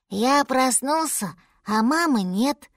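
plaintive small mammoth, drawn out; lost baby mammoth. Acted. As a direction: explain slowly in a small child voice.

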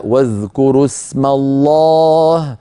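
slow intoned واذكروا اسم الله; slow tajwid chant; Quran recitation. Shown as a demonstration. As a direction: recite the verse slowly. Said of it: "قوله تعالى".

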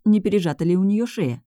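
ordinary delivery, nothing marked

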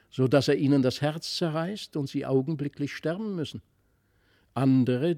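plain reading voice

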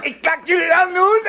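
high, loud panicked threat; frantic high-pitched warning; shrill threat. Acted. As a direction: threaten them while caught. Captive threatens attackers.

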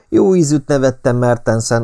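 plain reading voice

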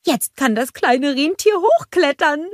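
high, excited snowman voice; Olaf. A narrator's reading. Snowman thrilled for the reindeer, high excited voice. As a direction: marvel in a high character voice. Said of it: "staunte Olaf".

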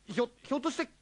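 high, loud startled stammer; jolted, nervous question; alarmed. Acted. Alarmed at being asked.

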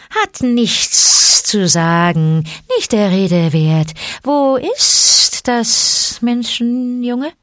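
hissing snake. A narrator's reading. Python brushes off thanks, asks for boy, slow hiss. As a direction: stretch every s like a python.

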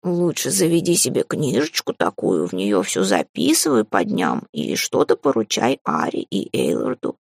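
very low, slow, creaky cat voice; advising cat familiar. A narrator's reading. Cat advising his mistress on a secretary, low drawl.

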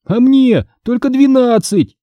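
high, slow desperate shouts; shouting back. Acted. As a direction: yell back at the ghost in protest. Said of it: "закричал я". (отчаянный крик)

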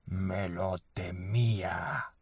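low, slow, hollow ghostly murmur; a dead voice rising from the tomb; voice from beyond the grave. Acted. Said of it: "dice con una voz de ultratumba". (voz de ultratumba lenta y grave)

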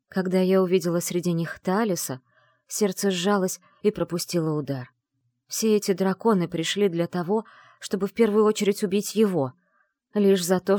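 usual speaking voice

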